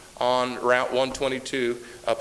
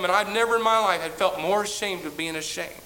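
ordinary talk

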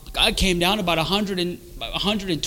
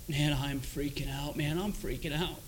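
very soft, drained muttering; hushed panic; 2 semitones lower, 14 dB quieter than usual. Retold or imitated. Man breaking down before his wife, hushed. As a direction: say it under the breath, overwhelmed.

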